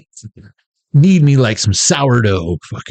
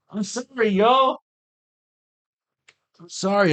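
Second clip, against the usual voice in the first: much higher, soft sheepish sorry; mock-contrite squeak; squeaky apology; 9 semitones higher, 7 dB quieter than usual. Real, not acted. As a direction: apologize in a tiny voice. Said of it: "apologizing in a squeak".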